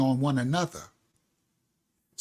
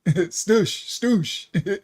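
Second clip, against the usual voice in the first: a light put-on Jamaican patois on "Stoosh", said with a laugh; half-joking West Indian patois "Stoosh"; fake patois accent. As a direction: toss off "Stoosh" in a playful mock patois. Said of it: "I just did my fake patois accent".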